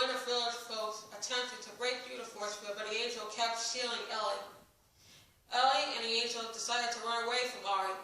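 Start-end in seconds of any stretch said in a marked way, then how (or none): none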